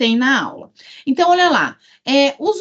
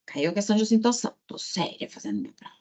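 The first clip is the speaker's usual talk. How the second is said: hushed, quick aside fading almost to a whisper; quiet exam muttering; 2 semitones lower, 9 dB quieter, much faster than usual. Shown as a demonstration. Teacher acting out herself sitting an exam, muttering quietly. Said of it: "Vou fazer assim, eu tô lá na prova".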